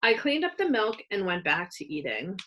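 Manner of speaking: ordinary delivery, nothing marked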